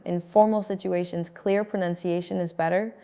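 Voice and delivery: normal talking voice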